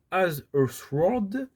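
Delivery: slow, careful attempt at an English accent; a French speaker trying an English accent. Shown as a demonstration. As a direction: sound out the English title in a shaky English accent. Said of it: "J'espère que mon accent anglais n'est pas à chier".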